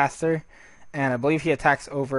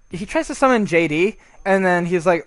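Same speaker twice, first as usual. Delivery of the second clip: ordinary delivery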